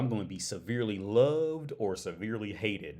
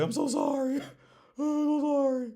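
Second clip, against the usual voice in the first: high, louder, pleading with a laugh; hammed-up apology; 6 dB louder than usual. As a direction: play the begging, cartoonish apologizer. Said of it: "or just say".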